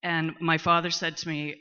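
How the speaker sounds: ordinary talk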